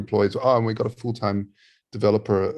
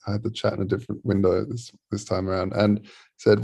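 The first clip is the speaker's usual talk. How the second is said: usual speaking voice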